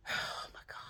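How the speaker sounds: exasperated unvoiced whisper. Real, not acted. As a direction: breathe it out tiredly. Exasperated sigh after rant.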